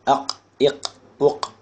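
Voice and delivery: "அக், இக், உக்" closed on a back q; qaf drill. Shown as a demonstration. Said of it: "இதுக்கு முன்னாடி உள்ள எழுத்து பார்த்தோம்".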